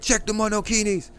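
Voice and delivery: high, sing-song command; mock urging message. Fans pinging Wu Fei in Telegram, teasing. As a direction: pitch it up like a cheeky ping. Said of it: "at him and be like".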